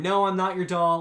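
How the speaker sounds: put-on defiant child refusal. Voicing Asuka refusing mother.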